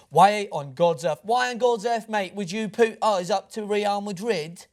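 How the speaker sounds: exaggerated Cockney complaint; Cockney accent. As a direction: put on a Cockney football fan, drawn out and indignant. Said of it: "That was my Cockney accent".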